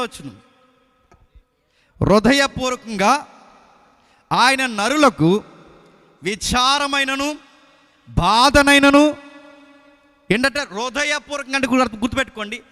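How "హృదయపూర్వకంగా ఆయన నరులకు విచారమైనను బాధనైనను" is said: solemn scripture reading. Acted. Reading scripture verse aloud.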